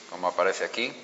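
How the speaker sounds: normal talking voice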